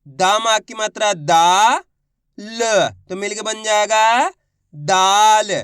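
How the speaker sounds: slow teacher's sounding-out, दा held long; stretched sounding-out. Shown as a demonstration. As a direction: build दाल sound by sound. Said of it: "तो खींच के पढ़ेंगे".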